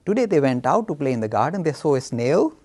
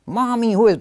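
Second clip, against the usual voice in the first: put-on little boy voice; 7 semitones higher, 6 dB louder than usual. Starts Ganesh's line again.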